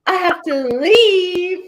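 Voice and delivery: drawn-out, high-pitched huffy exit; mock offended. Imitating the offended date walking out, mock huffy. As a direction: imitate someone hugely offended, slow and high. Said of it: "got all offended and was like".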